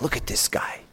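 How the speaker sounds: hushed, whispered admiration; whispered in mock awe; awed whisper. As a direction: whisper it as if pointing out a marvel. Voicing awe at a learned man.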